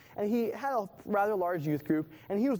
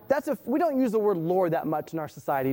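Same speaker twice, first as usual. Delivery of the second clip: ordinary talk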